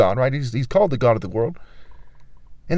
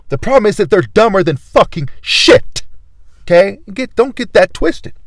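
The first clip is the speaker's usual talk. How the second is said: louder, higher, furious at the women; angry rant; 5 semitones higher, 5 dB louder than usual. Real, not acted. Vlogger ranting at women who rejected him, angry. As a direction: spit it out like he's been wronged. Ranting at critics, rising anger.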